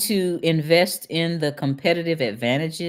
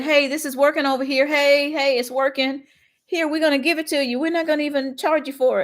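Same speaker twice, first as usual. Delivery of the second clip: high, fast, friendly voice of the people; high cheerful townsfolk; 11 semitones higher than usual. Retold or imitated. Voicing Seattle folks offering their program for free, high and cheerful. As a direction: cheerfully offer it up like eager neighbours. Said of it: "The people said".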